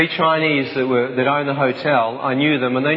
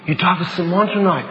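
put-on higher, urgent French-accented friend; Danielle, urgent; 5 semitones higher than usual. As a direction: tell him urgently he'll die. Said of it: "he went".

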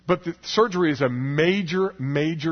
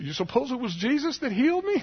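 high-pitched, overwhelmed wondering question; so excited; 10 semitones higher than usual. Retold or imitated. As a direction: imitate an excited man, voice climbing high. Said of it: "he was, like, so excited".

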